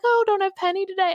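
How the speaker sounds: brightly noticing the dog is missing; cheery barista. Retold or imitated. Barista asks about Penny, high cheerful voice. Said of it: "she came up like".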